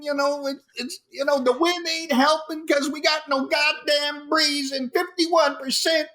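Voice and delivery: a sweltering reporter hamming up his misery; animated hot weatherman. Shown as a demonstration. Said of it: "I wanted to have a little animation into it".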